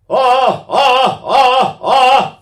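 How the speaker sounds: short, loud, high "ah" shouts; demonstrated "ah" bursts. Shown as a demonstration. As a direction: punch out repeated open "ah" calls. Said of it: "Let's say I go".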